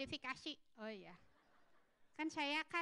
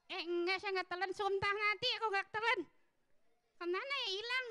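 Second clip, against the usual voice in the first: high puppet voice, climbing in protest; 8 semitones higher, 5 dB louder than usual. Acted. Puppet swearing it didn't swallow the microphone, high protesting voice. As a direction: deny it as an accused little puppet, high and insistent.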